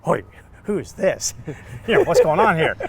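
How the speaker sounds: high, loud, startled yelp of confusion, laughing into it; loud laughing alarm at being grabbed. Retold or imitated. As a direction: caught off guard by a stranger grabbing him. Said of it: "I'm like".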